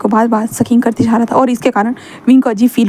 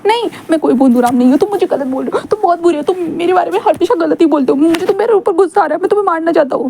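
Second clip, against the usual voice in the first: higher whiny voice, hurt, building to anger; sulky young man; 6 semitones higher than usual. Acted. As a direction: pout and complain like a wounded boy. Wing voiced, sulking.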